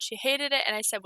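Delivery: ordinary delivery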